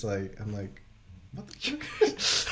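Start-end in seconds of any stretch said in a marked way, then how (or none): 1.3-2.5 drawn-out, high whispered disbelief with a laugh; slow, incredulous whisper, played for laughs; mock disbelief. Mock disbelief at son's demand.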